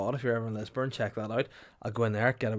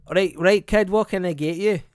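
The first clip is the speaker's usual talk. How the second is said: loud, higher brusque cafe worker voice; cafe lady; 8 semitones higher, 11 dB louder, slower than usual. Retold or imitated. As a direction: bark it like a gruff Edinburgh cafe lady.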